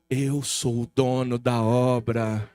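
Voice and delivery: God declaring to the pastor, deep and slow. Retold or imitated. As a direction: proclaim it slowly as God speaking. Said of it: "Deus tava falando assim pra mim".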